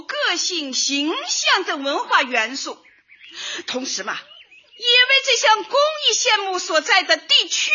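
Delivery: ordinary delivery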